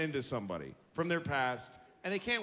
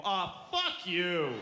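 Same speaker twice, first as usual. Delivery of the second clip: dismissive parent; much slower than usual. Retold or imitated. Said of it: "They go".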